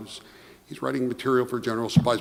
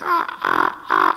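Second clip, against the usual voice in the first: much lower, guttural mock guffaw; imitated mocking laugh; 3 dB louder, slower than usual. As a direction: imitate the boss cracking up at him. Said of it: "He's laughing even harder".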